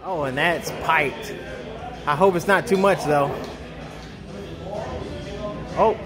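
ordinary talk

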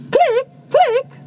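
short high bird clicks; bird chorus. A narrator's reading. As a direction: chirp the word sharply and high. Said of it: "said all of the birds".

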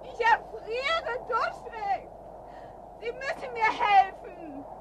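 high, slow pleading; high drawn-out desperate plea. Acted. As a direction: beg slowly, freezing and afraid. Freezing, begging for rescue.

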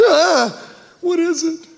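high, panicked mock worry; anxious cry. Pastor voicing a frightened patient, high anxious cry. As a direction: voice jumping up in fright. Scared person awaiting test results.

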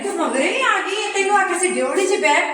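loud dramatic exasperation; dramatic loud aunty. Acted. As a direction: wail it like a fed-up aunty. Loud exasperation, neighbor followed.

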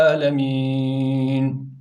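alemin drawn out very slowly with a throaty ayn, in recitation; recited alemin. Shown as a demonstration. (yavaş tecvidli "alemin")

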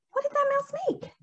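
usual speaking voice

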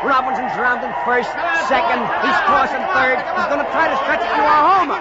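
high, fast ballgame call rising with the hit; live play-by-play. Acted. Narrator calling the hit for radio listeners, excited play-by-play. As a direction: call it like the ball's still in the air.

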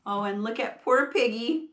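plain reading voice

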